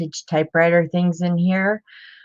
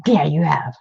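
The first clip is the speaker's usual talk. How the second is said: whispered aside. Real, not acted. Aside to viewer while browsing.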